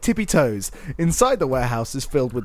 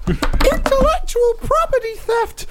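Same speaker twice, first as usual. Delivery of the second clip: very high, slow cartoon mouse; Disney Mouse voice; slower than usual. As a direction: squeak each word out slowly. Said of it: "been the Disney Mouse".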